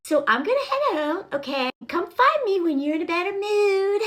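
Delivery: high, singsong mock-motherly voice; mom to a child. Woman leaving her sulking boyfriend, like a mom to a child. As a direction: lilt it up as if to a toddler. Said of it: "how a mom has to talk to a child".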